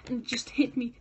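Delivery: ordinary talk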